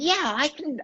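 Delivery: put-on a teenager's Australian boast; Australian accent. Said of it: "I'm gonna do my Australian accent again".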